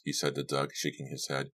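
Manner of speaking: plain reading voice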